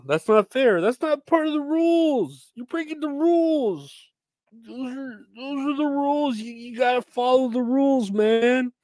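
very high whiny voice scolding about the rules; mock squeaky rule enforcer. Vlogger mocking society's gender rules in a very high whiny voice. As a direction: squeak it like a fussy hall monitor.

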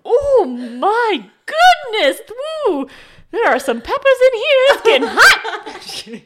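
high, loud, slow laughing swoon. Retold or imitated. Reader swooning over the flirt, hammed-up and laughing. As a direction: fan yourself and swoon, laughing. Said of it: "I was like".

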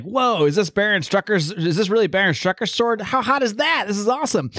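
put-on a villain thrilled by a sword like a giddy kid; kid in a candy store. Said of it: "like a kid in the candy store".

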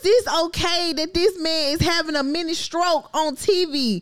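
high, loud, drawn-out disbelieving whine; exasperated rant. Real, not acted. Host venting about old government officials, exasperated loud whine. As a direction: cry out at how absurd it is.

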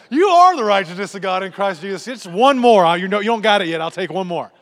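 loud, high preacher's proclamation; comically forceful declaring; mock bold declaration. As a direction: belt it out over the top, playing for laughs. Mock declaring between cigarette puffs.

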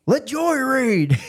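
high, stretched-out mock clamour; eager classmates. Alabama classmates wanting him to read, high eager call.

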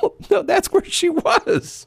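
very high, drawn-out, helpless laughter; really cracking up while talking; laughing disbelief. Real, not acted. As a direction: squeal it out through laughter. Cracking up at guessed punchline.

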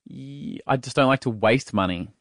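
ordinary talk, nothing marked

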